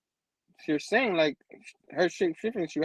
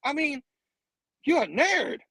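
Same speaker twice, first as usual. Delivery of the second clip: high-pitched, stretched-out disbelief; amazed fangirl pitch; incredulous; 9 semitones higher than usual. Acted. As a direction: tease him in a high, amazed voice. Acting fan's incredulity at secret identity.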